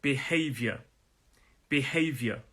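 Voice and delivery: "Behavior" as bi-HAYV-yuh, falling; measured British "Behavior", ending in yuh; three-syllable model. Shown as a demonstration. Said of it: "there are three syllables in this word".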